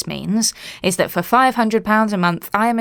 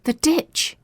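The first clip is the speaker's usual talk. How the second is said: put-on high, bright-eyed gasp of a little girl; a child's wide-eyed excitement; excited child; 10 semitones higher than usual. A narrator's reading. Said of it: "wide-eyed with excitement".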